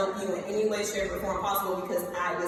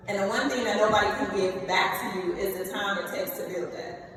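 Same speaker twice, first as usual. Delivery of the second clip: ordinary talk, nothing marked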